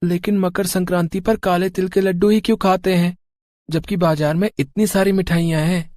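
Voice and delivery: put-on high young boy's voice, sulky; a small boy insisting he'll have fun; child voice. Little Bunty talking to his parents, high child voice.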